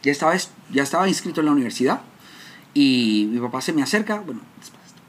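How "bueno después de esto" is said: whispered aside. Real, not acted. Speaker whispering an aside to his audience. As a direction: whisper it as a side remark.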